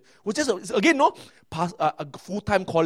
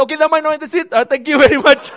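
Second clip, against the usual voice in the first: laughing dismissal; 11 semitones higher, 11 dB louder than usual. Retold or imitated. Interviewers stopping his Malay reading.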